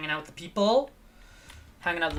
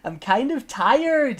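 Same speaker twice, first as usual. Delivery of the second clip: silly slow voice; 9 semitones higher, 8 dB louder, slower than usual. Pretend. Slow put-on high voice.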